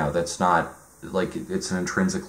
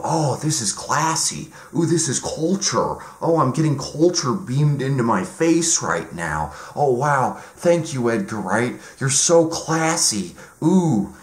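mock cultured fan; 6 semitones higher than usual. Mocking Americans who find Edgar Wright classy, high pretentious cooing. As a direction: gush as if culture were beamed at you. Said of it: "we Americans just go".

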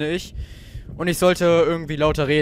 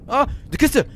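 excited; 11 semitones higher, 4 dB louder, slower than usual. Real, not acted. Spots a chest.

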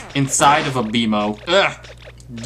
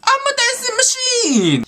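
very high, slow squeaky parroting; mimicking the soundboard voice. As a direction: echo the toy robot, hammed up and squeaky.

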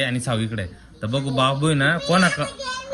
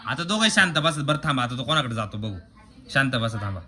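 usual speaking voice

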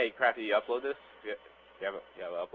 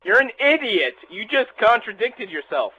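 loud, exasperated, high; exasperated yelling; 8 semitones higher, 8 dB louder than usual. Real, not acted. Friend yells at friend over the tripod, exasperated loud. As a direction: yell it at a friend who contradicts himself.

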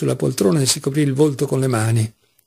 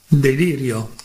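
ordinary delivery, nothing marked

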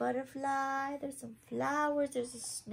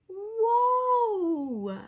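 high, loud, stretched gasp of wonder; amazed whoa; 11 semitones higher, 9 dB louder than usual. Acted. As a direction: let the garden take your breath away. Crowd sees the hidden garden.

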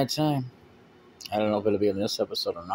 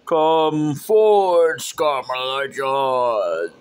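slow menacing villain; 3 dB louder, much slower than usual. Acted. Villain summons minion.

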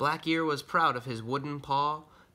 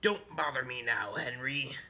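dismissive pirate. Acted. Busy pirate brushes Henry off.

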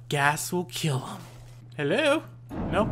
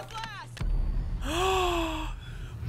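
long, high gasp; shocked gasp; 12 semitones higher, 6 dB quieter than usual. Real, not acted. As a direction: suck in air in shock. Suddenly killed in game.

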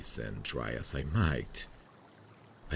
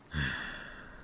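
soft airy sigh; 6 dB quieter than usual. Real, not acted. Tender sigh before goodnight.

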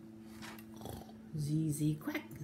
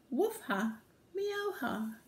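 high woof-ha and higher meow-ha; laughing dog and cat; 7 semitones higher than usual. Acted. Farm animals waking with a laugh, high pretend laughing. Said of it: "waking up with a laugh".

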